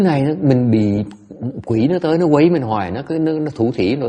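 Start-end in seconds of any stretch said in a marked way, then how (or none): none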